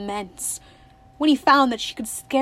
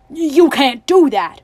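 loud, raised roar of protest; 4 semitones higher, 12 dB louder, slower than usual. A narrator's reading. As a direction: roar it out, slow and forceful. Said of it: "roared Tuck".

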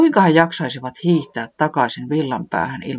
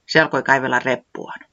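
ordinary delivery

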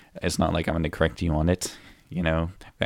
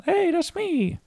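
very high, squeaky reply; squeaky cartoon voice; slower than usual. Co-host answers the introduction in a squeaky high voice. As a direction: pipe up like a cartoon critter.

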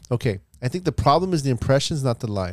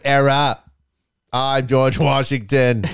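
slow mock colonial English; a hammed-up founding father; mock old British accent, slow; 3 dB louder, slower than usual. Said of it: "Englishmen back then are like".

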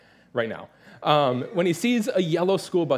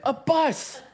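much higher, gleeful yelp with a laugh; a grown man blurting out his joy at a school bus; excited shout; 10 semitones higher than usual. Real, not acted. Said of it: "been like".

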